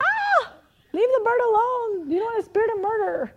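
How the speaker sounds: high, loud hammed-up panic; mock freak-out. Aunt catching children plucking the bird, mock hysterical. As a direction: shriek a comic scolding, half laughing. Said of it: "and I flip out, like".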